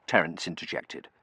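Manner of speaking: ordinary delivery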